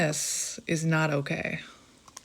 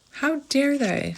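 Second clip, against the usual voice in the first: mock outrage; 8 semitones higher, slower than usual. Mock outrage at the other person.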